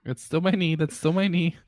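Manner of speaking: lightly voiced annoyed woman; mock annoyed complaint. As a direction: echo the line in a mocking voice. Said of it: "echoes the joke".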